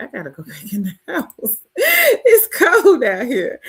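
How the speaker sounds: laughing retelling of ducking back in from the cold. Retold or imitated. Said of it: "I was like".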